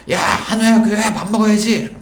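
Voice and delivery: acted parent's mealtime call. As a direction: call the kid in for dinner, pitched high. Parent calling child in for dinner.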